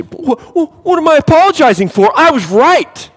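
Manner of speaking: high, loud indignant whine; mock offended high-pitched protest; self-righteous protest. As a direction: sputter like you can't believe you owe anyone. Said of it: "and you say".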